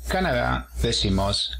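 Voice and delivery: ordinary talk, nothing marked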